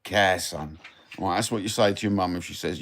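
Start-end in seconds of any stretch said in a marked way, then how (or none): 0.0-0.8 Cockney phrase in two separate parts for repeating; Cockney repeat-after-me. Shown as a demonstration. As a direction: teach the Cockney retort, pausing between parts. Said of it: "So say it after me".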